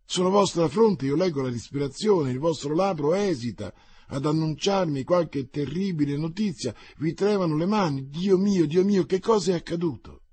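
high, loud, growing dread; mounting anguish. Acted. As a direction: voice rising in desperate fear. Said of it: "in angoscia sempre più fiera". (angoscia crescente, voce alta e forte)

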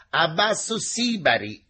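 high, loud slogan thrown back; shouted retort. A narrator's reading. Teacher turns to the engineer, shouting back. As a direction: call back with playful defiance.

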